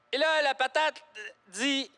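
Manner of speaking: usual speaking voice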